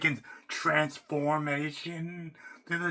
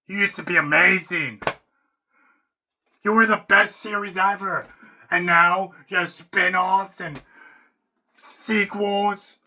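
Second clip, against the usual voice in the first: louder, higher fan lament; loud rant; 5 semitones higher, 9 dB louder than usual. Real, not acted. Fan ranting at Star Wars, loud and higher. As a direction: call out to the franchise in loud dismay.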